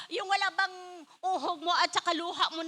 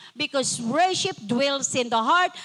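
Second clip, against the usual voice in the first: ordinary talk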